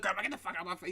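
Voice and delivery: very soft mock back-talk; imitated defiant grandson. Friend mimics Donovan walking off from grandma, muted back-talk. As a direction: swear at grandma under your breath, in imitation. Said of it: "He's like".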